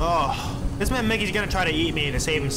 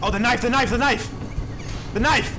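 short panicked cries, rising higher; panicking; 5 semitones higher than usual. Real, not acted. Player scrambling for the knife in the game, panicking. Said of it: "Okay, I'm panicking".

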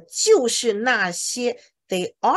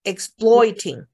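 Exploiting drawn out slowly with clear oi; slow careful Exploiting, oi stretched; slow model; 2 semitones higher, 5 dB louder, slower than usual. Shown as a demonstration. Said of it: "oi，oi的音".

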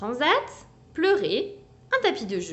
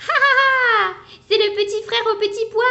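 very loud, high laughing; hearty mock laugh; burst of laughter; 11 semitones higher, 8 dB louder than usual. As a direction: crack up at the little brother. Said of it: "s'esclaffent Alistair et bébé koala".

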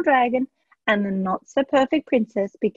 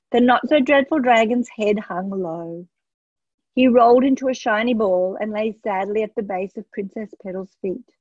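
ordinary delivery, nothing marked

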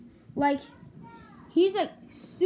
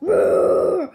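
mock scare shout; 2 semitones higher, 10 dB louder than usual. Jump scare on a kid.

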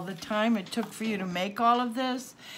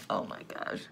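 whispered, much lower and softer, choked up; overcome whisper; 12 semitones lower, 10 dB quieter than usual. Real, not acted. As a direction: let it slip out on a breath, overwhelmed. Overcome by the gift.

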